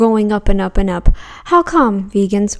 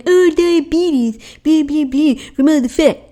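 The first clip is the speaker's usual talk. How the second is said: mock critic; 5 semitones higher than usual. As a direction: sneer out a critic's line. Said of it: "so don't go blame".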